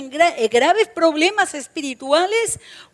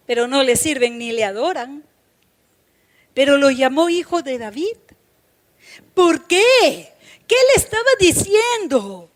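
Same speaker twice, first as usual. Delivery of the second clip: ordinary talk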